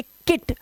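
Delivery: किट with a quick short "i"; short vowel sound. Shown as a demonstration. Teacher contrasting with long vowels, short vowel sound. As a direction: keep the vowel in किट brief, "kit". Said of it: "ये छोटा ई होता है".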